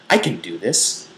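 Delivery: ordinary talk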